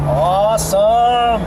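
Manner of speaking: slow, high, loud "awesome"; drawn-out excited cheer. Real, not acted. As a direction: stretch it out in delight. Thrilled car is fixed.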